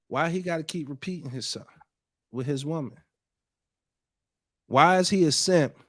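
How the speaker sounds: ordinary delivery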